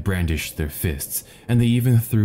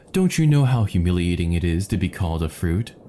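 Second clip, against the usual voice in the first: usual speaking voice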